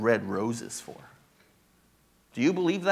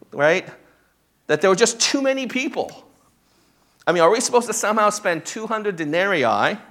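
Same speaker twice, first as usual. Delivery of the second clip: higher, louder, fast and exasperated; exasperated disciples; 5 semitones higher, 4 dB louder than usual. Retold or imitated. Pastor acts the disciples protesting to Jesus, exasperated and loud. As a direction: voice the disciples throwing up their hands. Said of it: "they respond in exasperation".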